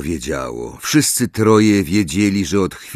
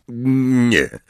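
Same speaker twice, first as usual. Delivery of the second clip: usual speaking voice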